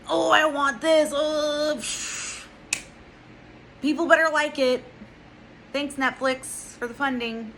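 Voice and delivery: high, loud, smug whiny voice; mocking the director. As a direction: sneer it out like a self-satisfied director. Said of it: "I don't know why I did that".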